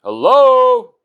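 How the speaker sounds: shouting hello into the void; loud frustrated call. Acted. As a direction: yell into the dark, fed up. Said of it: "Loud and frustrated".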